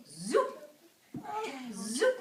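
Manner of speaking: loud zoop, sliding upward; sudden rising whoosh of a word; magic wand zoop. Acted. Storyteller imitates the magic wand, loud rising zoop.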